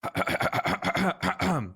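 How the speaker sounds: long, stuttering throat clear; drawn-out preparatory throat clear. Pretend. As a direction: clear the throat at length before announcing. Preparing to make the announcement.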